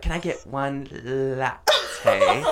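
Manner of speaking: "latte" said slowly as "la-tay"; slow, laughing imitation of mom saying "latte"; mangled latte. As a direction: drawl out "latte" like a mom who can't say it. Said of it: "she can't even say latte".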